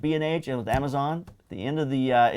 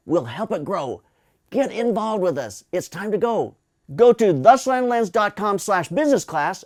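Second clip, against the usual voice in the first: upbeat ad read for the business class; promo read; 5 semitones higher than usual. Acted. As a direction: sell the class like an ad. Reads a course ad.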